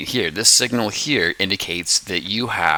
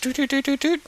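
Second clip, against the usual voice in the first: high staccato sound effect; imitated pulses; 4 dB quieter, faster than usual. Shown as a demonstration. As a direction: run of high little doots. Imitates charge being established.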